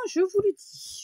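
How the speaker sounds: loud, high-pitched playful proclamation; mock-solemn announcement, pitched right up; emphatic declaration. Playful repeated declaration, first take.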